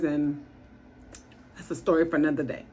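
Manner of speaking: ordinary delivery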